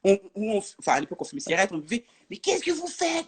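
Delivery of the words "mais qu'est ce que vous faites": mock-indignant cop, voice pitched high; retold police challenge. Police to young women drinking in the street, high indignant challenge. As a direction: imitate an officer calling people out, pitched up. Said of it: "les policiers qui arrivent et tout".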